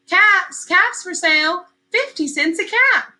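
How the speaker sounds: high, loud street-seller call; calling out wares. A narrator's reading. Peddler calling out caps for sale, high and loud. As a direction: call out to the town to sell caps. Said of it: "he walked back to town calling".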